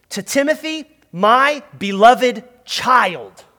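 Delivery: put-on "my beloved child" read very slowly, stress on "my". Shown as a demonstration. Pastor reading verse two slowly for the congregation to echo. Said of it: "Would you say".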